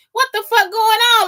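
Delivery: retold bewildered reaction, voice jumping up; baffled outburst. Speaker relives confusion at eyes being moved, incredulous.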